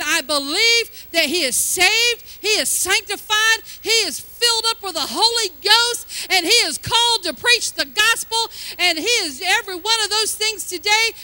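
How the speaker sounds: bold declaration. Retold or imitated. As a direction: declare it like a prayer of faith over your son. Declaring her son's salvation aloud.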